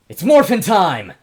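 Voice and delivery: loud, high morphin' shout; battle cry. Acted. Luna shouting her battle cry to the crowd. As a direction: yell it out to start the transformation. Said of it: "as she goes".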